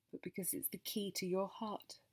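ordinary delivery

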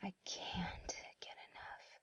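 soft breathy whisper into the ear. Acted. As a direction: lean close and whisper it. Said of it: "Here, I'll whisper it in your ear".

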